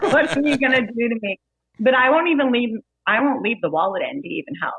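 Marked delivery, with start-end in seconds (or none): 0.0-1.4 laughing through a nervous question; laughing quote. Retold or imitated. Bartender imitating a victim, laughing as she quotes. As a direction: giggle out the victim's worried line. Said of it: "they're always like".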